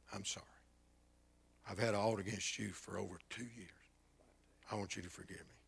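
low, whispered, humble plea; hushed apology. Retold or imitated. Preacher whispers sorry to the deacon, humbly. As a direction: breathe the apology out like a confession.

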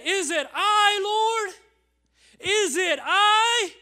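high, loud, slow plea; pleading cry. Retold or imitated. Disciples asking Jesus at supper, loud and pleading. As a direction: cry out the question, drawn out. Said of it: "They all said".

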